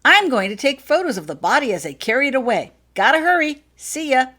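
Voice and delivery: loud, high, eager dash; boy rushing out with a camera, loud and high; excited hurry. Acted. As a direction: call out while rushing off.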